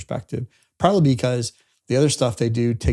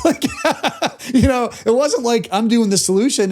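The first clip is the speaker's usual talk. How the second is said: laughing; 10 semitones higher than usual. Real, not acted. Customer recounting the credit card question, laughing. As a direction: laugh breaking into higher-pitched talk. Laughs while telling the billing story.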